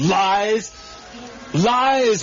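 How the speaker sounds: high, rising outrage at the word; indignant echo. Acted. Earl bristling at his wife calling him a liar, indignant. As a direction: throw the accusation back.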